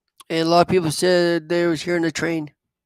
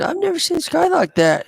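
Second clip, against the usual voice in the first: pitched well higher, lightly voicing grandma in awe; a hint of grandma marveling at the strange sky; grandma quoted; 11 semitones higher than usual. Grandma telling the kids about the green sky, higher and amazed. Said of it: "She said".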